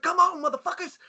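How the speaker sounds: very high-pitched mock yell; retold fight taunt. Cornered teen daring four attackers, high acted yell. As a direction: call them on, voice way up.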